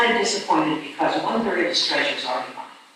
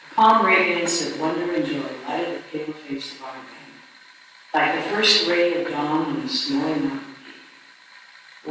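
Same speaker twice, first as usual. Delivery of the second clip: hushed story reading; 3 semitones lower, 5 dB quieter than usual. A narrator's reading. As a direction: read the ending like a quiet benediction. Reading the book's closing lines.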